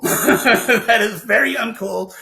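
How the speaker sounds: slow, loud, laughing through the words. Real, not acted. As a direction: crack up while saying it. Laughing at scary story.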